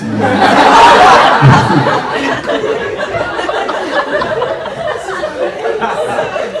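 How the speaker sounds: high breathy burst of laughter; big laugh. Real, not acted. Comic breaking up at his own line, laughing hard. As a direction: crack up at the crowd.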